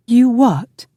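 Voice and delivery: high, loud disbelief; outraged. A narrator's reading. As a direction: explode in indignant disbelief. Outraged wife in a quarrel.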